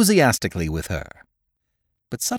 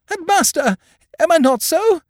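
much higher, frantic protest; wild plea; 4 dB louder than usual. Acted. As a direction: plead wildly, voice flying up. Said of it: "said Ruth wildly".

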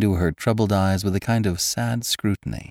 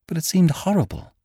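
much higher, rising distress; horrified; 12 semitones higher than usual. Acted. Girl recalling Ferrara's proposal, horrified. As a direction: horror creeping into the voice.

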